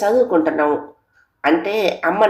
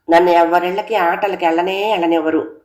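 plain reading voice

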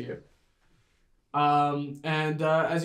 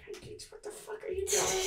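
very high, hushed scolding; tiny voice hissing aside at a tattletale; furtive little creature; 11 dB quieter than usual. Acted. Bully scolds Pancakes on the sly.

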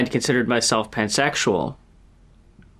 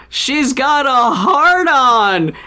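high, slow, gleeful retelling of an excited shout; acting out a character shrieking the news to the camera; giddy announcement; 10 semitones higher, 4 dB louder, much slower than usual. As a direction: announce it delightedly, laughing at the camera. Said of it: "looks directly into the camera and says".

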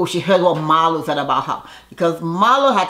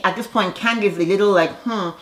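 ordinary talk, nothing marked

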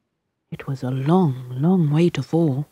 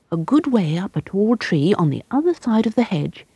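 ordinary delivery

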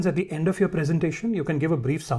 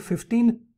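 emphasise "fifteen" as the corrected number; stress on fifteen; 6 semitones higher than usual. Shown as a demonstration. Presenter correcting a figure for the audience, stress on "fifteen". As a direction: put clear weight on "fifteen". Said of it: "You're putting emphasis on both these words".

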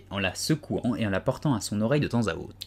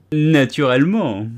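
louder, slow scornful cry; scornful exclamation; 5 semitones higher, 10 dB louder, slower than usual. A narrator's reading. The Hatter scoffs at Alice, louder and slow. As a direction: sneer the word at her. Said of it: "d'un air de mépris".

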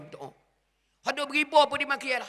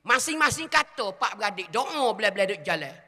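normal talking voice

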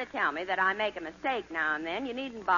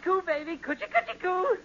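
put-on baby talk cooing; 8 semitones higher, faster than usual.

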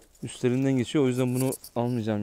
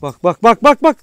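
loud shout; 9 semitones higher, 14 dB louder than usual. Real, not acted. Shouting as dog attacks.

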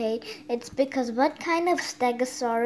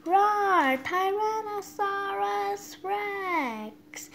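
1 roaring T-Rex; 8 semitones higher, much slower than usual. Pretend. Voices the hatching T-Rex.

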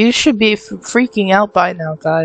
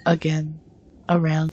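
ordinary talk

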